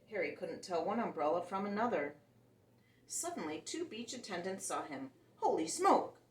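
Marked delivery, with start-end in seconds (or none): 5.4-6.2 loud, high startled exclamation; gasped. A narrator's reading. Beach attendant gasping in shock at the creature. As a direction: blurt it out in shock. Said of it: "one of them gasped".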